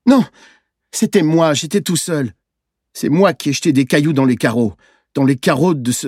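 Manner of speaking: put-on high, insistent child's outburst; distressed confession. A narrator's reading. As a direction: blurt out the confession. Boy bursts out confessing. (aveu d'enfant affolé, voix haute)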